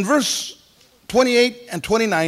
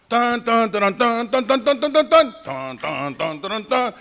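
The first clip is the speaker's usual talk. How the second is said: playful band imitation; mock band fanfare; 2 semitones higher than usual. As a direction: sing out the band's fanfare as dun dun. Imitating a welcoming brass band.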